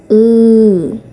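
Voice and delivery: ऊ said alone as a clear drawn out oo; model vowel demo. Shown as a demonstration. Teacher showing students how to say ऊ, clear and careful. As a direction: sound out ऊ for the class to copy. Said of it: "इसे ऊ बोलना है".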